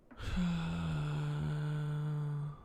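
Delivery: long, drawn-out sighing exhale. Real, not acted. Coder stuck on the animation, long sigh. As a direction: let out one long sigh. Stuck while coding alone.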